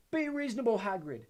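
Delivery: loud, high yell; yelled appeal. A narrator's reading. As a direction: yell it across the grounds. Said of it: "A man yelled".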